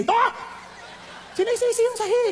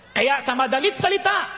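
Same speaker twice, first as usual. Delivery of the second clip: usual speaking voice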